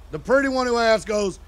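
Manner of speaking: ordinary talk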